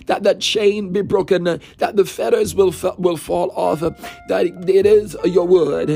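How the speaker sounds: usual speaking voice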